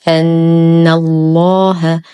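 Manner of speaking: slow recitation, long nasal hum on the doubled ن of أن, heavy ل in الله; full ghunnah. Shown as a demonstration. Teacher shows students the Name of Majesty, full ghunnah. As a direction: hold the نّ in أن الله, then a thick لّ. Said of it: "نشد النون بغنة أكمل ما تكون".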